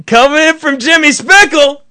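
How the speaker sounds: high, loud hammed-up announcer; radio host mocking the recurring question, high and loud; announcer intro. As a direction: shout it like a cheesy show intro. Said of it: "play it, and then say".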